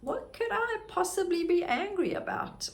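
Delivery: high, baffled question to herself; what, me angry? sky-high and puzzled; incredulous. Retold or imitated. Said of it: "And I'm like".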